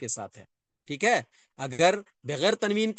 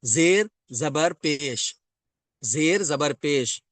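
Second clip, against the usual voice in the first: slow drill; much slower than usual. Shown as a demonstration. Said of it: "teacher drilling vowel names".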